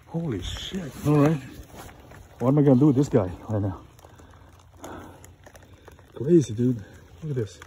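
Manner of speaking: fast, loud thrill while holding it; excited loud. Real, not acted. Still holding the fighting crab.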